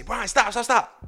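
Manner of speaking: high, quick acted plea to stop; urgent halting. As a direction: imitate friends cutting in to stop him. Friends urgently halting him.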